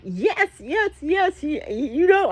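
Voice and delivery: high eager yes yes yes; eager agreement. Retold or imitated. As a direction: gush agreement, high and quick. Bayley answering eagerly.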